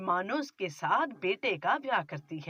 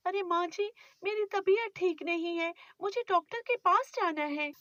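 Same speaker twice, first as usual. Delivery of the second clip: put-on higher, pleading young wife; young bride; 8 semitones higher than usual. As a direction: defend yourself meekly to your mother-in-law. Bride asks.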